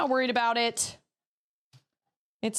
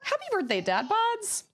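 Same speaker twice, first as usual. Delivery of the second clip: excited; 6 semitones higher, slower than usual. Real, not acted. Wishing a viewer happy birthday.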